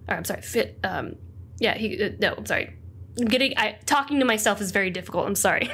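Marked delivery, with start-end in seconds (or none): none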